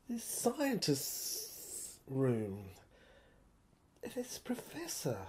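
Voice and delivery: hushed whisper, voice dropped away. Acted. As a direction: breathe the word out in awe. Hushed realisation about the dead man.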